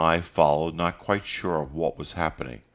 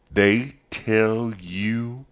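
higher, drawn-out angry question; curt demand, pitched up and stretched out; 6 semitones higher, 3 dB louder, much slower than usual. A narrator's reading. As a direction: press the question slowly with restrained anger. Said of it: "his voice curt, angry".

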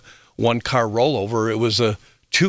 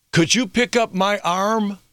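retold calling out; 9 semitones higher than usual. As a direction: imitate a crash victim calling from across the road. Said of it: "He yells out to me".

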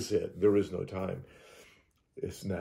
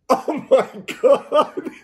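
loud, high, drawn-out exclamation breaking into laughter; retold astonishment at full pitch; amazed; 12 dB louder, much slower than usual. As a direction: cry it out slowly in laughing disbelief. Retold amazement at friend's dream.

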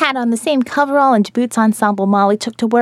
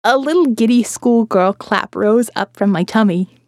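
usual speaking voice

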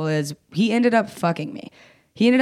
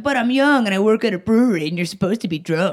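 mock self-justifying; 3 semitones higher, 3 dB louder than usual. Acting out her own excuse.

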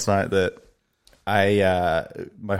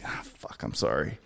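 whispered, sheepish apology; 5 semitones lower, 12 dB quieter than usual. Retold or imitated. Apologizing to the annoyed flight attendant, in a sheepish whisper. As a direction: breathe it out, embarrassed. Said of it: "And I was like".

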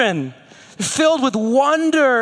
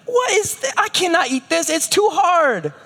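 put-on fussy toddler whine; 8 semitones higher than usual. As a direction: complain like a picky toddler. Whiny toddler rejecting toast.

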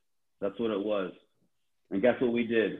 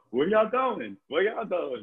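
higher-pitched mock call of a friend asking where they're headed; retold friend's high call; imitating Baez; 7 semitones higher, slower than usual. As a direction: play the friend running up, curious. Baez voiced in a retold story.

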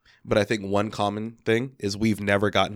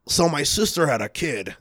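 lightly acted comic premise, higher pitch at the start; stand-up bit opener; 5 semitones higher than usual. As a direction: launch it like a stand-up setup. Mocking stock comic premise.

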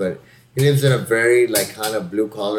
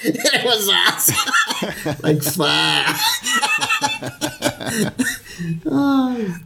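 laughing; 13 semitones higher, much slower than usual. Real, not acted. Laughing hard at the post.

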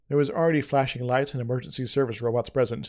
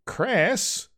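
name gasped out high; stunned to see his friend alive; in shock; 8 semitones higher than usual. A narrator's reading. Avok sees young Chris alive, shocked. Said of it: "said Avok in shock".

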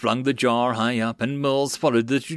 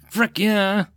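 a cheer, pitched up and louder; triumphant soldier's cheer; 8 semitones higher, 4 dB louder than usual. A narrator's reading. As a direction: whoop at the burning catapults. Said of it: "Mills cheered".

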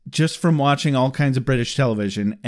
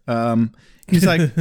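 ordinary talk, nothing marked

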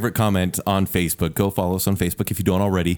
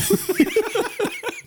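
squealing laugh; high-pitched laugh. Real, not acted. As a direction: burst into a high giggle.

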